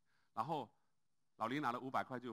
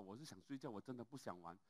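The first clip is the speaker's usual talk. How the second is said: sleepy refusal; 2 semitones lower, 10 dB quieter than usual. Retold or imitated. Sleepy Lao Lin refusing again.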